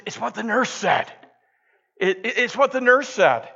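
high, stammering frightened voice; terrified patient. Retold or imitated. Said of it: "absolutely terrified".

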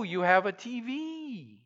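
normal talking voice

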